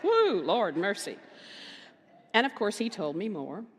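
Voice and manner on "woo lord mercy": relieved exclamation. Retold or imitated. Reacting to her own tense moment.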